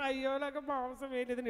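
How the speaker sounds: imitating a grown man whining over dinner; mock whine. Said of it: "ఏడుస్తాడు ఎవడన్నా?".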